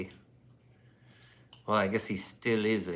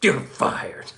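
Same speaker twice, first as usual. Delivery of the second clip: mock firing; 4 semitones higher, 6 dB louder than usual. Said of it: "They were like".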